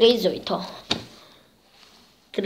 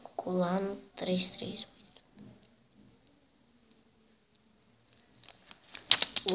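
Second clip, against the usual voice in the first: whisper; 11 dB quieter than usual. Real, not acted. Whispering while sticking.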